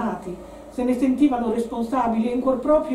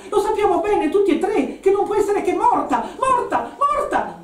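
high, frantic raised voice; agitated yelling; 9 semitones higher, 4 dB louder than usual. A narrator's reading. Woman insisting to companions that Francesca is dead, agitated yelling. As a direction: cry it out, hammering the last repeated word. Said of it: "urlò la donna".

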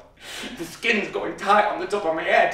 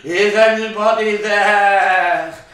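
slow, louder spirit call; 8 dB louder, slower than usual. Retold or imitated. Medium calls to the spirits.

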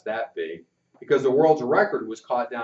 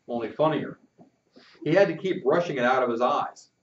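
plain reading voice

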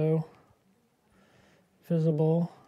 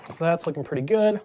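normal talking voice

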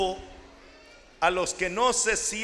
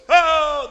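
loud sudden ah; loud glad exclamation; relieved sheep cry; 7 semitones higher, 12 dB louder than usual. Acted. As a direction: sheep hearing the shepherd's call.

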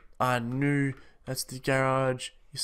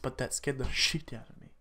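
usual speaking voice

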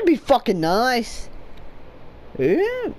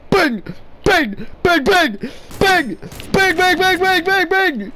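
shouted bang bang; 5 semitones higher than usual. Pretend. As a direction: yell each bang like a shot. Shouting bang while firing.

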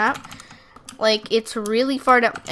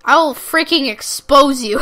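loud hammed-up mock threat, higher; joking shouted threat at a rude player; 4 semitones higher, 10 dB louder, slower than usual. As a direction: yell a fake threat at the kid you don't mean. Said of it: "I'm just kidding".

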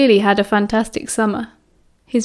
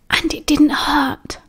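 slow, higher half-whispered question; hushed; 4 semitones higher, slower than usual. A narrator's reading. Girl asks Mary for intimate gossip, hushed. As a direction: ask it secretively, almost in a whisper. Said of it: "asked in a hushed tone".

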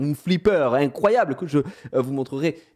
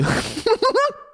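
squeaky high-pitched giggle; a shrill burst of amused giggling. Real, not acted. Laughing at his own line to his wife, high giggle. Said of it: "Oh, le lover!".

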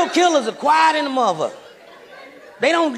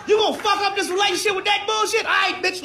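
high yell; 7 semitones higher than usual. Acted. Boyfriend yells at girlfriend.

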